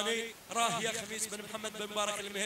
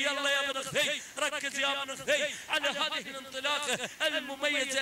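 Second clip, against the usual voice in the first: higher, energetic race call; live play-by-play; 5 semitones higher than usual. Real, not acted. Commentator calling the camel race finish, energetic play-by-play. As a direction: keep the race call running hot.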